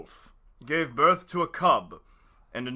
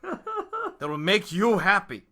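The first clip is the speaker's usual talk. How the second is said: louder Walken line through laughter; laughing Walken impression; 5 semitones higher, 11 dB louder than usual. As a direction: push through the giggles in Walken's voice. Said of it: "more Christopher Walken-ish".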